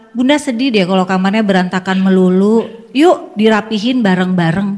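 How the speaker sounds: gently sad, inviting to tidy together; gentle I-statement. Shown as a demonstration. Mother softly asking child to tidy the room together. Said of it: "Kenapa nggak kita bilang".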